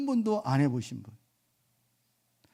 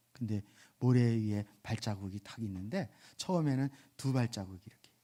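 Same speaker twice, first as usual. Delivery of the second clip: ordinary talk, nothing marked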